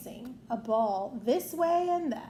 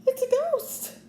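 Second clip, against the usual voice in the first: much higher, louder frightened cry; a startled gasp at the window; gasped fright; 4 dB louder than usual. A narrator's reading. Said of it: "Goofy gasped".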